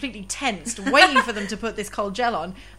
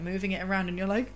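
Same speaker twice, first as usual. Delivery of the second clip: ordinary delivery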